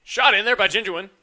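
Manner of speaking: loud excited shot call; excited shout. Real, not acted. Shot on goal in commentary.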